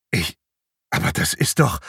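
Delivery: halting, broken-off protest; start, stop and leave it hanging; stammering. A narrator's reading. Patrick confronted with abuse accusations, stammering. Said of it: "stammelte Patrick".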